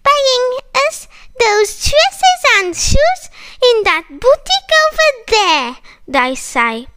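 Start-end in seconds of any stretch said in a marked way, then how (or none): 0.1-5.8 put-on high, quick, cheeky girlish voices; higher, fast, angling for a shopping trip; cheeky girlfriends. Friends asking the mosquito for dresses, high and cheeky.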